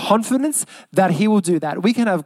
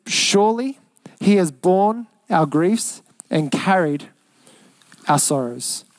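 slow scripture reading; slower than usual. Acted. Scripture quoted, then repeated.

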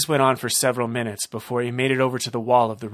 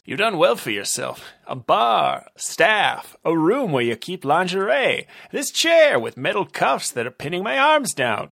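raised, louder wisecracking detective; detective taunts the club owner from his chair; cocky detective; 7 semitones higher, 5 dB louder than usual. Acted. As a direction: needle your captor with a grin. Detective voiced, higher, louder.

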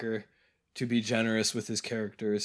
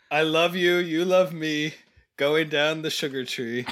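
higher, louder sing-song recital; proud clunky rhyming verse; 5 semitones higher, 4 dB louder than usual. Retold or imitated. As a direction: recite bad verse with pride. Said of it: "recites a terrible poem".

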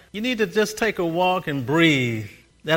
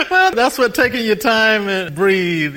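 still laughing; 5 semitones higher, 4 dB louder than usual. Real, not acted.

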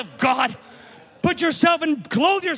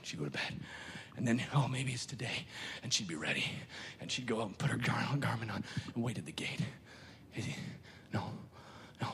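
soft breathy whisper, much lower and softer; hushed whisper; 13 dB quieter than usual. Acted. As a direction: draw them in with a secret whisper. Hushed retelling of waiting bride.

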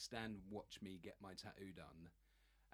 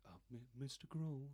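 nervous trembling; 3 semitones higher than usual. Retold or imitated. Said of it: "like my bottom lip going like this".

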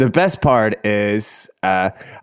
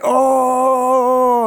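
very high, long-held group howl; imitated group "oh". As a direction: hoot a long high mock reaction. Group's retold reaction to revelation.

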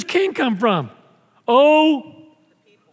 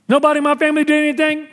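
rapid-fire; 7 semitones higher, 3 dB louder, much faster than usual. Real, not acted. Fast burst in life list.

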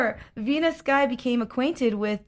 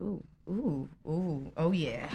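moaning; 3 semitones lower, 5 dB quieter, slower than usual. Retold or imitated. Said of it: "and I'm like".

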